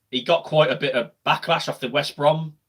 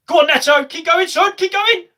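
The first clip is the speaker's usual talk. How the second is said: urging cheer; 13 semitones higher, 5 dB louder than usual. Real, not acted. Urging a player toward goal.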